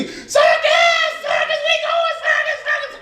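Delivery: put-on very high excited kid shout; a kid yelling with joy from the back seat; excited little boy. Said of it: "the little boy in the back seat".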